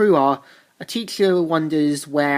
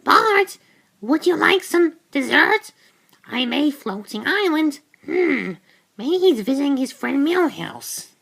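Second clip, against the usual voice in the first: much higher, sing-song motherly offer; sweet mother voice; 9 semitones higher than usual. Retold or imitated. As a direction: play the kindly mum offering dessert.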